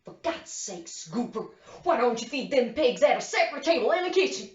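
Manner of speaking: mimicking Big Daddy's outburst, rapid and rising; retold shout. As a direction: build to a fast, mock-angry holler. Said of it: "and shouts".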